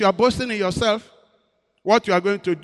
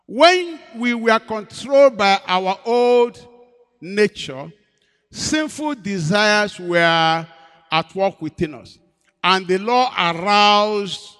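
slow scripture reading; 5 dB louder, much slower than usual. Acted.

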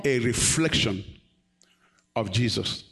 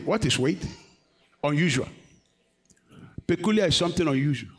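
usual speaking voice

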